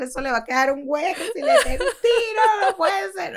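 high, laughing mock panic; high laughing cry of disbelief. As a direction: laugh through the horror. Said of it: "yo dije".

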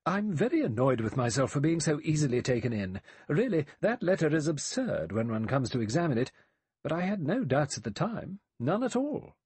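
briskly cross with oneself. A narrator's reading. Emily Brent admitting she was taken in, brisk. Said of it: "The elderly woman beside her said briskly".

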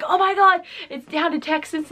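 freaking out. Retold or imitated. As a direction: panic happily over the vote count. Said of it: "I'm sitting there freaking".

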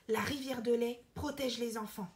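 ordinary delivery, nothing marked

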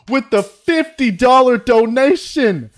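high, loud and slow, savoring each word; drawn-out hype over a big donation; excited announcement. Real, not acted.

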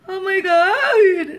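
slow, high, loud scared wail; frightened cry. Real, not acted. The shellfish are still alive.

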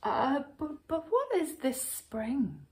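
high, hesitant question; nervous bear tripping over words; stammering. A narrator's reading. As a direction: timidly stumble into the question. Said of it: "the bear stammered".

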